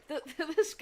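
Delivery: ordinary talk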